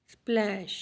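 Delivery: स्प्लैश repeated as a lone word with short "a"; short vowel demonstrated. Shown as a demonstration. Teacher repeating the example for pupils, स्प्लैश with short vowel sound. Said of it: "short sound आ रही है".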